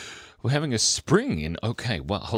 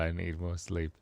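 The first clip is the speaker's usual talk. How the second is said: usual speaking voice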